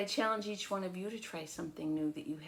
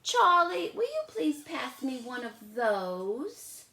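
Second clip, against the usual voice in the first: put-on higher, slow polite little girl; Lola asking sweetly; 6 semitones higher, 7 dB louder, slower than usual. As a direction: ask nicely, drawn out. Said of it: "she said".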